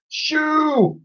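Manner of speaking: very high bawl. A narrator's reading. Twin bawling at the slime. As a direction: shoo it away. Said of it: "bawled Edmund".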